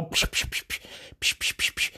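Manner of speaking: rapid unvoiced "psh" bursts; imitated psh noises. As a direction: fire off quick, soft psh sounds. Imitating rash action with noises.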